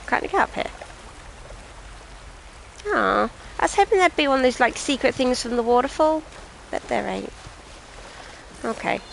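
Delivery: ordinary talk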